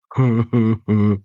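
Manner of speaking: a short taunting chuckle at the victim; mocking laugh. A narrator's reading. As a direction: laugh at them like a trickster. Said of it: "you can hear him laughing at you".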